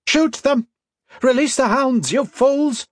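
very high, urgent shouted commands; furious shout. A narrator's reading. Miss Hakkendottir commanding her soldiers to attack, high furious shout. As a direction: shout orders pitched right up. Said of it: "Miss Hakkendottir shouted".

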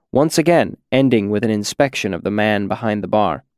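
ordinary delivery, nothing marked

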